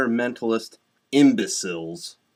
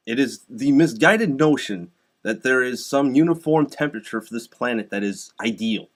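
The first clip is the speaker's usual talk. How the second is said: usual speaking voice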